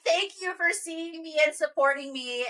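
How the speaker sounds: high, tearful gratitude, hammed-up; mock tearful thanks. Overwhelmed mom to supportive stranger, mock tearful. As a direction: imitate thanking someone through tears. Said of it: "crying tears of, like".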